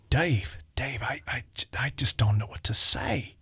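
hushed, stunned whisper. Retold or imitated. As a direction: breathe it out as if lost for words. Ray devastated, speechless.

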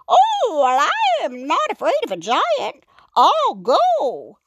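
very high, swaggering frog voice; boastful. A narrator's reading. Frog volunteers before the crowd, boasting. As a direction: brag grandly in a high-pitched character voice. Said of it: "he boasted".

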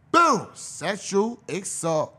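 ordinary talk, nothing marked

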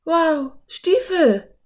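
put-on girl spotting cowboy boots; excited cry. A narrator's reading. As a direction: exclaim in delight. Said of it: "rief Anne".

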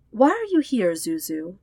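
sleepy sister asking her brother; voiced sister. A narrator's reading.

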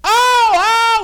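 high, loud cry of "oh, oh"; mock scream of pain. Beaten driver crying out under the baton. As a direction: yelp out a beaten man's cry. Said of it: "he was screaming for all he was worth".

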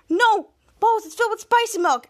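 acted cry to stop someone; urgent warning.

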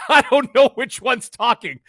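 loud, high and drawn out; mock confusion. Podcaster imitating the chat unable to tell two hosts apart, mock confusion.

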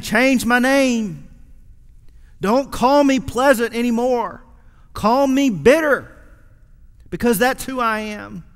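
preacher pressing the congregation on Naomi's bitterness; fervent preaching. Acted. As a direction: drive the point home from the pulpit. Preaching with rising force.

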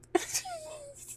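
a sudden high-pitched burst of laughter. Real, not acted. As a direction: laugh it off, squealing. Laughs at own joke.

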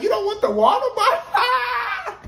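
high, loud, laughing disbelief; shrieking incredulous quote. Retold or imitated. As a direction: squeal it in mock shock through a laugh. Mocking her refusal of water.

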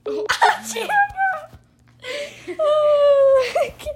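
high, loud cackling laugh; laughing hard. Real, not acted. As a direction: break up laughing. Laughing at 911 prank.

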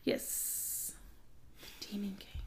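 hushed whisper. Real, not acted. Gushing to her co-host about the next book, whispered. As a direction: breathe the name like a secret thrill.

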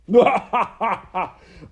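loud, high cackle; ringing, gloating ha-ha-ha; villainous laugh. Acted. Rat laughs from the sidelines, villainous laugh. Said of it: "Botticelli laughed from the sidelines".

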